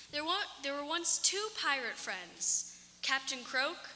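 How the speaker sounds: ordinary delivery, nothing marked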